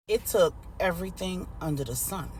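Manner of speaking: plain reading voice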